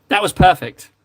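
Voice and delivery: ordinary talk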